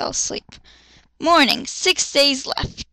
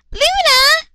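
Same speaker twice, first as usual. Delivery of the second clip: put-on very high, loud childlike call; high squealing kid yell; 5 dB louder than usual. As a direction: shout her name running up. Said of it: "Yachiru yelled".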